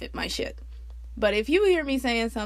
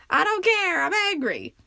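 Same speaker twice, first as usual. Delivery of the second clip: high, loud, defiant snapping at the staring drivers; defiant yelling at people staring from their cars; defiant angry; 10 semitones higher, 4 dB louder than usual. Retold or imitated. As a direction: throw it at the onlookers, angry and past caring. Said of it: "I'm like".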